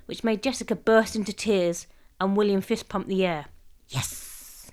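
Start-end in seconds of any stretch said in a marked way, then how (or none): 3.9-4.7 whispered little victory; said softly. A narrator's reading. William privately triumphant over the girls, softly. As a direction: hiss it under your breath. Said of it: "he said softly".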